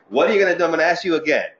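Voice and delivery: mock-stern parental repeat; stern father asking again. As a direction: press the question as a warning. Father asks again after smack.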